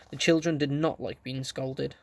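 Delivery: ordinary delivery, nothing marked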